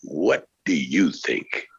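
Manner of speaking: low and slow; slow low producer voice. Shown as a demonstration. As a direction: drop low, drawn out.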